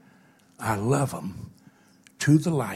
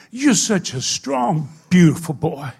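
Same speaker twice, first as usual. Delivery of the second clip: fervent praise; 5 semitones higher, 9 dB louder, slower than usual. Retold or imitated. Hugging a crippled boy.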